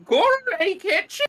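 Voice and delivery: excited shriek. Real, not acted. Excited reaction to cast reveal.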